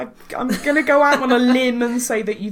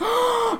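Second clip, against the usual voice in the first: sharp high gasp of recognition; surprised gasp. Real, not acted. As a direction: gasp in sudden shock. Reacts to mummers.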